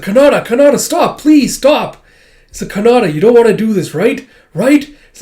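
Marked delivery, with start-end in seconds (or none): 0.0-2.0 high, loud frantic plea; acted desperation, shouted; desperate pleading. Alma begs Kanata while holding her back. 2.7-4.9 high, fast, loud appeal; acted desperation, rising questions; desperate pleading. Alma pleads with transformed Kanata.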